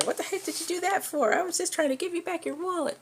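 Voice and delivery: hammed-up high yell of wronged frustration. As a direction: yell at her in outraged disbelief. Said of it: "he's yelling at her".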